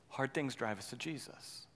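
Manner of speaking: soft, low, deliberate; quiet refrain. Acted. Pastor repeating the theme softly and low. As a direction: say it softly as the core truth.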